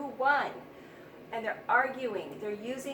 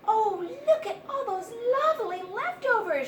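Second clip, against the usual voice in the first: high, loud, excited little mouse squeak; squeaky mouse; 10 semitones higher, 6 dB louder than usual. A narrator's reading. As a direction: squeal it like a delighted hungry mouse. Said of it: "she que-, squeaked".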